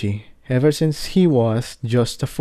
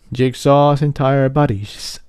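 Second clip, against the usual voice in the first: plain reading voice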